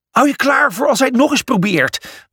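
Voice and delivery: a lightly voiced bossy order; commanding. A narrator's reading. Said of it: "beval ze".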